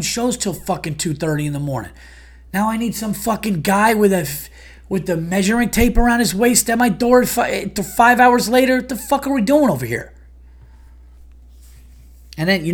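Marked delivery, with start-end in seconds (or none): 2.5-10.1 comic venting about a contractor at his door; rising rant. Real, not acted. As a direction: vent like it's all too much. Ranting about early contractor.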